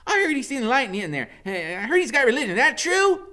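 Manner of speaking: high, loud chatter; high giddy woman. A narrator's reading. Loretta guessing to Iggy, high and loud. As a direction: gossip eagerly.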